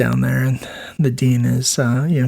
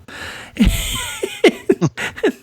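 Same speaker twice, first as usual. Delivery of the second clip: a long airy laugh, no voice; breathy laugh. Real, not acted. Nurse laughing at telling the senator to strip, breathy laugh.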